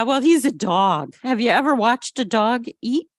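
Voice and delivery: plain reading voice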